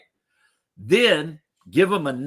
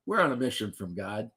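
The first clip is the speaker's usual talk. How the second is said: deadpan quiet; 4 semitones lower, 7 dB quieter than usual. Pretend. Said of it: "they're like".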